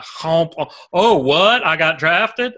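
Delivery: lightly put-on Southern accent, surprised; a big Alabama lineman acting amazed, Southern accent; mock Southern drawl impression. Fan imitates Landon Dickerson learning he got drafted, mock Southern accent. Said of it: "a voice that you came up with".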